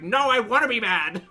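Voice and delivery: loud, high-pitched mock tantrum; frustrated angry outburst. Acting out her own angry crying, frustrated outburst. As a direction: act out indignant, tearful frustration at full volume. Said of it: "trying to be calm and being like".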